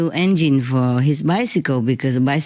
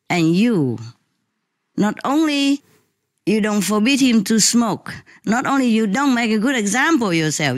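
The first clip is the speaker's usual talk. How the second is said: higher, heated scolding lecture; retold angry lecture; 6 semitones higher than usual. Lecturing the father about his son's smoking, heated and higher. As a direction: lecture the father, pitch raised and insistent. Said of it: "I continue my lecture".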